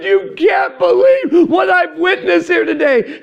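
high, loud, distressed pleading; overwhelmed missionary calling his wife in distress; retold breakdown. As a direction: pour out panic to your wife on the phone. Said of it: "I just started crying".

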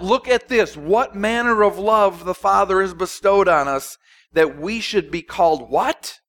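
can hardly believe this love; blown away. Retold or imitated. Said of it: "That's, that's the tone".

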